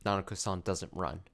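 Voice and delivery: ordinary talk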